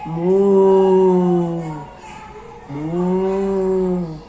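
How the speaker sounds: low, drawn-out mooing; cow moo. Pretend. Imitating the cow's sound.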